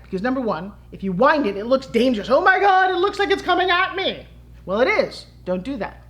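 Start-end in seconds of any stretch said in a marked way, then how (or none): 2.3-4.3 high, loud mock panic; alarmed onlooker. Frightened onlooker seeing the wound toy coming, mock-alarmed. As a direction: exclaim in hammed-up alarm, pitch way up.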